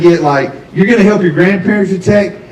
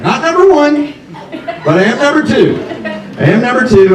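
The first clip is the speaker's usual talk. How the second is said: higher, proudly announcing; mock pride; 8 semitones higher than usual. To the audience about being her backup contact, mock proud and higher. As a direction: boast about being second.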